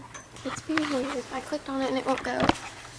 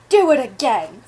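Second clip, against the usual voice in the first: stern order; 10 dB louder than usual. Acted. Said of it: "said the stepmother".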